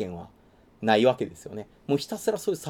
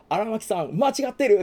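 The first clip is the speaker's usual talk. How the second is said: higher pitched, rising to a louder rebuke; youthful rebuke; 9 semitones higher, 3 dB louder than usual. Retold or imitated. Young member scolding Aramaki, rising high and loud. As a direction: call him out like a young comrade.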